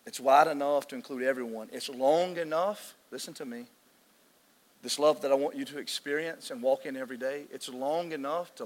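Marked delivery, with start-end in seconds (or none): 1.7-3.0 slow emphasis. Real, not acted. Drawn-out preaching emphasis.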